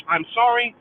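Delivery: ordinary talk, nothing marked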